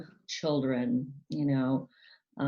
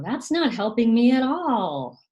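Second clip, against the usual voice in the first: higher, louder, exasperated scolding; irritated parent; 8 semitones higher, 7 dB louder than usual. Retold or imitated. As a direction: snap at a screaming kid. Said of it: "they may say things like".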